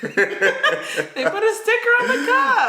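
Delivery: high, loud and slow through laughter; squealing with laughter; laughing. Real, not acted. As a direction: say it while cracking up. Friend laughing mid-story.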